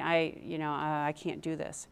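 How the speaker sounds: a hint of nervous defeat; anxious self-talk. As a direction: mutter it as if losing nerve. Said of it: "we find ourselves saying".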